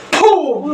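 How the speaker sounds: a sharp puff of breath blown out; blowing puff. Real, not acted. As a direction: blow a quick burst of air as if casting out a spirit. Healer blowing on the patient.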